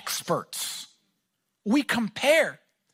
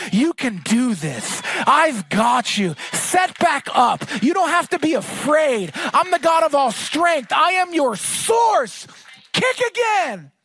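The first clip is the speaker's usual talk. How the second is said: rousing shout, building louder and higher; God cheering on; 3 semitones higher, 3 dB louder than usual. Acted. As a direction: urge them on with rising force. Preacher voices God rallying the listener.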